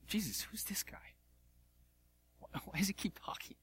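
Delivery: hushed whisper behind a hand; a sly whispered aside to Jesus. Retold or imitated. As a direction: whisper it so Peter won't hear. Moses aside to Jesus about Peter.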